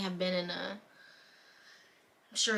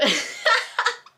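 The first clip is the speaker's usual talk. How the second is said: high laugh; 14 semitones higher, 8 dB louder than usual. Real, not acted. Laughs after the comeback.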